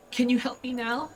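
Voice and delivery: put-on high-pitched little kid voice; small child. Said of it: "the small child just goes like".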